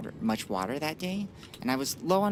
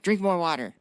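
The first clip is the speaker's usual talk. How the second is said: emphatic advice; 6 semitones higher, 7 dB louder than usual. Real, not acted. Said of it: "advice to viewers".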